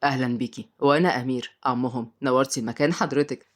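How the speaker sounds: low-pitched male character voice; deep-voiced, polite host; courteous uncle. A narrator's reading. Narrator voices the children's uncle.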